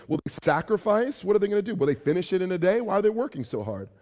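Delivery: mocking taunt. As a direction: scoff at the wall builders. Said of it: "a mocking rhetorical question".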